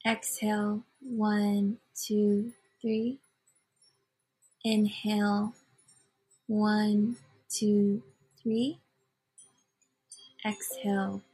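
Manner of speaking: measured meditation guide, long pauses between phrases; unhurried breath-counting cadence with lifted phrase endings; guided meditation. Real, not acted. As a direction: lead listeners through it in short, spaced phrases.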